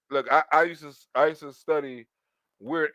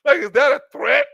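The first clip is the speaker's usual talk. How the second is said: higher, louder startled question; mock alarm; 8 semitones higher, 7 dB louder than usual. Real, not acted. Comic asking the crowd if it's a threat, startled. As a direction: act shocked at the threat.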